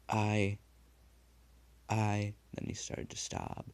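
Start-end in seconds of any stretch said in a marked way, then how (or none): 0.1-2.3 stammering. A narrator's reading. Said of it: "He stammered".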